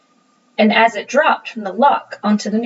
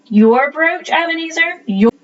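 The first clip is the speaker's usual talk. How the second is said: louder and higher, offended; indignant; 7 semitones higher, 4 dB louder than usual. A narrator's reading. Tom scoffing at Ebenezer's claim, indignant.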